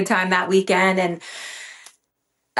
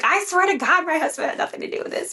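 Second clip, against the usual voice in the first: high, rushed, softer at the end; retold desperate plea; 8 semitones higher, 3 dB quieter, faster than usual. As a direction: blurt out a pleading oath. Retold plea for her husband.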